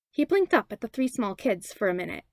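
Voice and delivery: ordinary delivery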